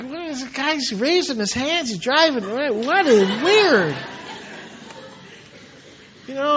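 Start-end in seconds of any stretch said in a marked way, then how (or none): 0.0-4.0 mock bafflement, last word drawn out; baffled onlooker. Onlooker watching a driver raise his hands, mock-baffled. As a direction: act the puzzled passer-by, stretching the final word.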